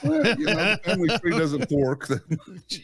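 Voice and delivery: high, laughing quip; laughing joke. Real, not acted. [name] joking about his family tree, laughing. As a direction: toss off the joke while laughing.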